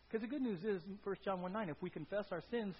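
normal talking voice

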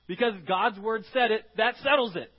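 loud, emphatic declaration; forceful preaching. Real, not acted. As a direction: drive the truth home with force. Preaching the point.

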